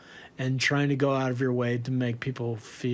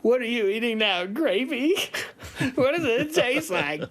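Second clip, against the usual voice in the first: much higher, whimpering, tearful voice; hammed-up tearful call home; mock crying; 8 semitones higher than usual. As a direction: wail like a homesick kid. Crying bandmates calling their parents.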